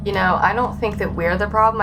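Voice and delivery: ordinary talk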